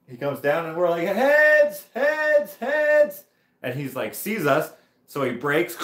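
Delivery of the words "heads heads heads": loud, high repeated call; shouted warning. Retold or imitated. Riders warning an oncoming biker, loud high shouts. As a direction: yell a warning down the hill.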